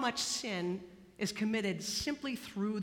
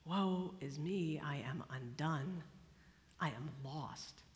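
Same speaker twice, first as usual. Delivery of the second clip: lower, softer, slow and undone; hushed, slow despair, barely audible; broken confession; 8 semitones lower, 9 dB quieter, slower than usual. Acted. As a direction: whisper it out like a man who knows he deserves to die. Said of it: "he deserves to die".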